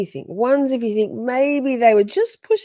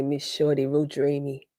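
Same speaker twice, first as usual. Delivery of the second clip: lightly imitated American accent, lower; imagined American accent; 6 semitones lower, 3 dB quieter than usual. Host imagining the witness's compliment, lightly imitated American accent. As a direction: try his American way of talking, low-key. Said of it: "I can imagine he'd say".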